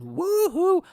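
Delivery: loud high whoop; mock victory cheer for China. Real, not acted. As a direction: whoop it out in triumph. China number one cheer.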